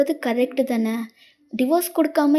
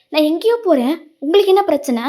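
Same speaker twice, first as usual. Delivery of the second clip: raised defiant retort; 5 semitones higher, 5 dB louder than usual. Acted. Wife snaps back at husband.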